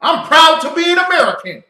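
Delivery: high, loud defiant yell; defiant colonists. Retold or imitated. Preacher acting the rebels' defiance, yelling high. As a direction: roar it like a rebel crowd. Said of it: "They said".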